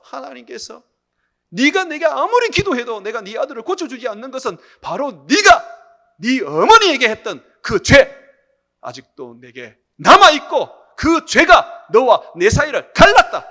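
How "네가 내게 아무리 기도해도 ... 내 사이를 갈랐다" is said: loud, high declamation, building to slow hammered phrases; thundering divine rebuke. Retold or imitated. God telling the deacon his sin divides them, thundering rebuke. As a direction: pronounce judgment as God, shouting each phrase.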